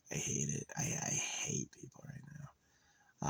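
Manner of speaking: breathy unvoiced whisper of disgust; whispered aside. Real, not acted. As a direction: hiss it under your breath. Muttered confession.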